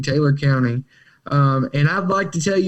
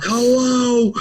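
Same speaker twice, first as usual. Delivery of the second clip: much higher, curt mock-rough phone greeting; aggressive answer; 7 semitones higher than usual. The dope man picking up the phone, mock aggressive greeting. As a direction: bark out an impatient hello. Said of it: "answer aggressively like they always do".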